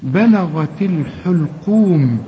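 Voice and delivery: بلغت الحلقوم recited slowly with light lam; drawn-out Quranic reading of بلغت الحلقوم; teacher modelling tajweed slowly; slow recitation. Shown as a demonstration. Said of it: "اللام هنا برضو مرققة".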